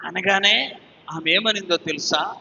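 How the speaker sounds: ordinary delivery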